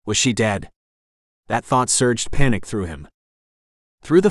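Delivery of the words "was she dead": usual speaking voice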